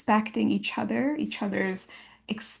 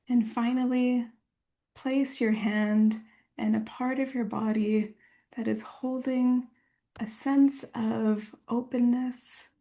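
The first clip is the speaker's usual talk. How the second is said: guided meditation. Acted. Leads a guided body meditation.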